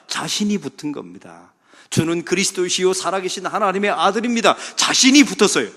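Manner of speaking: loud, high preaching that drives each point home; fiery preaching. Acted. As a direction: preach it to the back pews. Preaches at full force.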